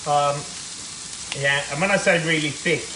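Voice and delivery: normal talking voice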